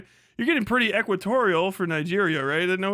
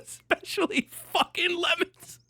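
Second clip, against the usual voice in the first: incredulous; 10 semitones higher than usual. Real, not acted. Incredulous mock reading.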